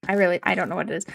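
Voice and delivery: rushed "I don't know" run together in a Canadian accent; fast Canadian accent. Shown as a demonstration. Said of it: "I talk funny".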